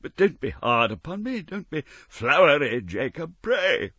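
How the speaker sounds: put-on frightened old man's plea; pleading Scrooge. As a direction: beg the ghost to go easy. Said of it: "said Scrooge".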